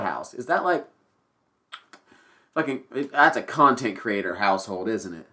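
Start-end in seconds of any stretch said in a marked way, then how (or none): none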